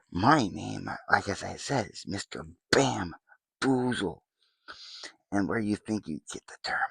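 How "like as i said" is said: ordinary talk, nothing marked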